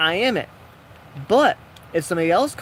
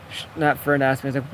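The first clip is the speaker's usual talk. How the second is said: normal talking voice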